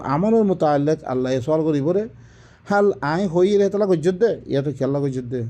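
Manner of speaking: ordinary talk, nothing marked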